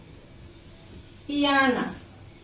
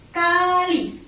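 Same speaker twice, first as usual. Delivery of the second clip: "cáliz" with CÁ stretched and raised; stress on first syllable; 4 semitones higher, 4 dB louder than usual. Shown as a demonstration. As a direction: dwell high on CÁ in "cáliz", then drop on LIZ. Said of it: "¿En dónde se carga la voz?". (cáliz con la voz cargada en CÁ, alargada)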